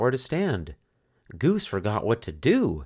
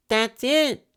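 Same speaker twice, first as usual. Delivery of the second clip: high sudden burst of discovery; excited cry; 3 dB louder than usual. A narrator's reading. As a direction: exclaim it, high and bright with an idea. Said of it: "cried Franklin".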